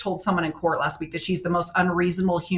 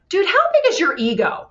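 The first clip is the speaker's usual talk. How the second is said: much higher and louder, mocking disbelief; incredulous mock; 10 semitones higher, 5 dB louder than usual. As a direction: squeak it out like you can't believe his ego. Mocking the professor's bragging.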